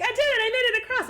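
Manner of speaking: high, fast mock cheer; very loud boast. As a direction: crow it out excitedly, imitating a noisy elf. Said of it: "he's very, very loud".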